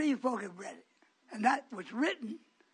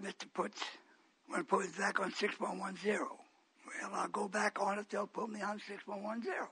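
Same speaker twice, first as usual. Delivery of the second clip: usual speaking voice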